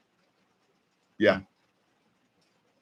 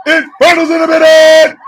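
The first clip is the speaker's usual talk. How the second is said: loud, high stretched announcer call; booming ring-announcer shout; hype shout-out; 8 semitones higher, 8 dB louder than usual. Acted.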